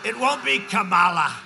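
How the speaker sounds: loud shout on the name Kamala; shouted rally punchline. Real, not acted. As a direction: drive the name home at the crowd.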